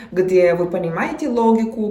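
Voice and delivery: ordinary talk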